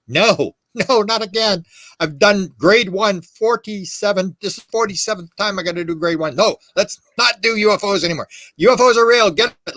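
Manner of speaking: high-pitched, mock-exasperated refusal; exasperated protest. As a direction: plead in weary disbelief, pitch jumping up. Acted exasperated refusal to repeat.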